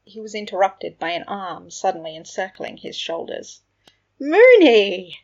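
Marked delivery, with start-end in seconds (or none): 4.2-5.2 very loud, high, jubilant call; cheerful exclamation. A narrator's reading. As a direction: sing out the nickname to greet a friend. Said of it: "Sirius exclaimed cheerfully".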